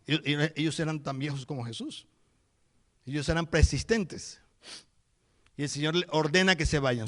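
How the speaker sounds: normal talking voice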